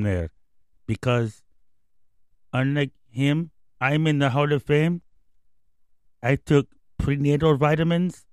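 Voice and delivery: lightly voiced Dominican slugger, broken English; a hint of David Ortiz's Spanish-accented English. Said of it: "Thank you, David Ortiz, our special guest".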